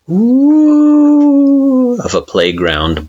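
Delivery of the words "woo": high, loud mocking woo like a bully; taunting wind woo. A narrator's reading. Wind taunting the sleeper, high mocking woo. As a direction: howl a teasing woo as the wind. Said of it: "the taunting".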